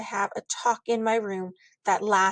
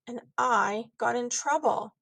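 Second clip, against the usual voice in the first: ordinary delivery, nothing marked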